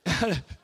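gasping, wheezy mock sobs; hitching breaths of a kid crying too hard; imitated asthmatic crying. Shown as a demonstration. Showing how hard crying sounds like asthma, mock gasping sobs. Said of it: "the kind of crying, it's like you don't know if you got asthma".

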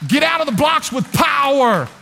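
preacher shouts to the congregation; preaching shout. Real, not acted. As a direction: fire them out of the blocks.

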